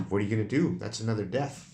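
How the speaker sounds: ordinary talk, nothing marked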